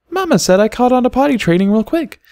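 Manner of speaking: a shy little filly confessing; little foal voice. A narrator's reading.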